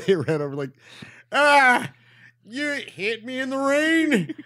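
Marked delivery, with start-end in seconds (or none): 1.3-4.3 high, loud, bellowed motivational-speaker voice; Matt Foley. Acted. Hitchhiker pleading after being hit, hammed-up loud high bellow. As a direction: bellow it in an over-the-top impression. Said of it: "might as well be Matt Foley".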